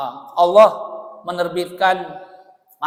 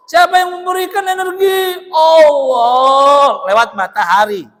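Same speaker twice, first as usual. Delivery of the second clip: high shouted question, then slow loud answer; trainer's shouted correction; 6 semitones higher, 5 dB louder, slower than usual. Retold or imitated. Trainer correcting the audience, shouting slowly.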